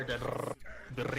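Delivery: the rrr with a hard rolled Filipino R. Shown as a demonstration. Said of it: "you can do the hard R".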